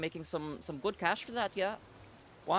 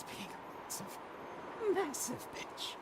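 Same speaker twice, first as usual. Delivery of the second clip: whispered insult; 13 semitones higher, 9 dB quieter than usual. Real, not acted. Venting about annoying Pokémon.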